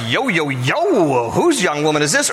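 lightly hammed-up interested flirt; catcalling flirt. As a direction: stretch it out like a mock catcall. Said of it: "is he just like".

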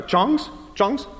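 a hint of a Brazilian server's accent; thick accent. Imitating the Brazilian-accented server offering tongs. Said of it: "pretty thick accents".

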